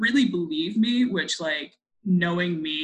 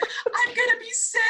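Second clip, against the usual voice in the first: high, squeaky retold wail; hammed-up weepy whine, pitched way up; mock crying wail. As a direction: imitate a tearful kid blurting it out. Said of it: "just crying".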